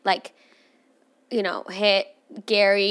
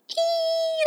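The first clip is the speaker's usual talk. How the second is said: short high-pitched creeped-out squeal. Retold or imitated. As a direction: let out a quick disgusted squeak. Showing how raccoons look.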